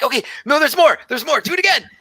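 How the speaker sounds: very high, breathless delight; excited tabaxi druid. Acted. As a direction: gush like a wide-eyed scholar. Druid urges tapping again.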